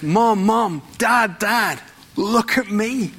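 lightly voiced little child calling mom and dad. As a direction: call out like a kid wanting attention.

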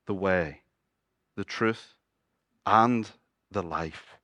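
low, hushed, with weighty pauses; solemn declaration. Acted. Jesus tells Thomas who he is, solemn and hushed. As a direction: set each phrase apart, softly and low.

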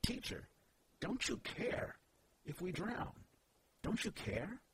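high-pitched mock plea; pleading disciples. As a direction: plead upward, like astounded followers wanting a response. Voicing the disciples' plea to Jesus.

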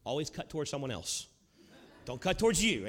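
ordinary talk, nothing marked

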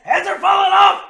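high, loud yell at full voice; excited shout. Real, not acted. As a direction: belt it out over the room.

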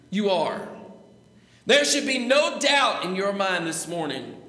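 loud, rolling revival-preacher delivery; preaching at full force. Acted. Preacher warning the lost congregation, full-force preaching. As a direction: preach it out to the whole church.

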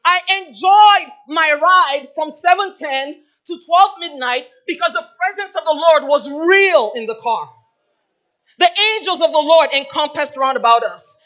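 loud, forceful preaching testimony. Acted. Preacher testifying to the congregation, loud and fervent. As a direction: proclaim it to the whole church.

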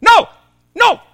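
loud, high, urgent shout; acted desperate volunteer's yell; crowd member crying out. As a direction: call out to stop an execution. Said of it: "somebody from the crowd goes".

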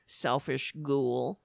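ordinary talk

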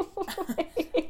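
loud high-pitched laugh; high laugh burst. Real, not acted. Laughs after her scream.

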